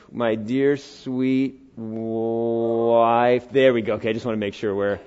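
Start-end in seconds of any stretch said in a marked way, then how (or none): 0.1-3.5 tender address. Real, not acted. Drawn-out affectionate repeat.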